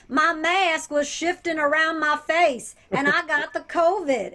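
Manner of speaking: mock Southern accent. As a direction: put on an exaggerated Alabama accent to mock him. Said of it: "I'm allowed to do that accent".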